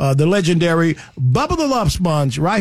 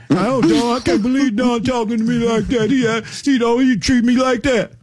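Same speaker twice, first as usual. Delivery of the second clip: whiny mock friend; 2 semitones higher than usual. Mocks offended friend whining.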